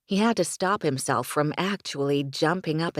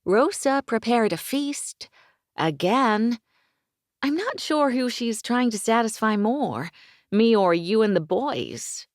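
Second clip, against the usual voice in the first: higher female voice, amused; higher, lively heroine voice; Amanda's voice; 7 semitones higher, 4 dB louder than usual. Acted. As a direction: play the heroine, lightly teasing. Woman voiced by narrator.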